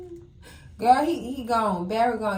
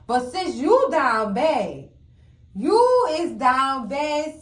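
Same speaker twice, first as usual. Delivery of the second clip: slow, emphatic scolding; slow emphatic you is down bad; 3 dB louder, slower than usual. Real, not acted. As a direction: drag it out to make the point land. Scolding Nancy slowly.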